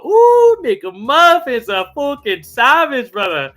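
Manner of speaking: high, loud, slow drawn-out hype; stretched, impressed hype shout; hyped reaction. Real, not acted. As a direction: ooh it up in hype amazement. Hyped reaction to viewer's comment.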